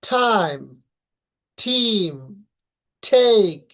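"টাইম, টিম, টেক" each with a puffed TH; aspirated T. Shown as a demonstration. Teacher showing how T is pronounced, aspirated T. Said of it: "টি এর উচ্চারণটা দেখি".

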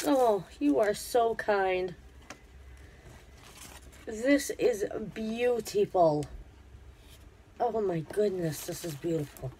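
really overcome, drawing the words out; emotional, moved. Real, not acted. Vlogger thanking gift-maker for her kindness, welling up. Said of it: "I'm very emotional".

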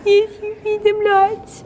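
high, slow playful insistence; teasing. Pretend. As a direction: draw it out as a joke.

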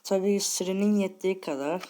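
chewing while talking; words round a mouthful of food; mouth full. Real, not acted. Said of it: "Bir şey çiğniyorum da".

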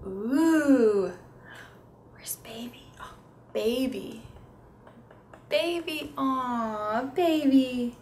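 put-on high, drawn-out cooing over the baby; sing-song baby talk. Shown as a demonstration. Therapist cooing to a toddler over the book. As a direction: coo at the picture like to a toddler.

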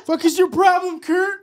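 high, loud shout at Kurt; mock outraged shout. Real, not acted. Host yelling at co-host over question, mock outrage. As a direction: yell it in mock fury.

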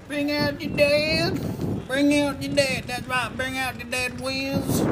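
high, slow hammy cart caller; town crier voice. Acted. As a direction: bellow it like a plague cart crier. Plague cart caller parody.

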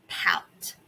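soft unvoiced whisper of pout; a whispered pout for the class; whispered word. Shown as a demonstration. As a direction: breathe pout with no voice. Whispered model word in drill.

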